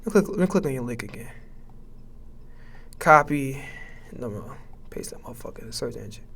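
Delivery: breathless, fast mumbling; out of breath. Real, not acted. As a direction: talk while short of breath.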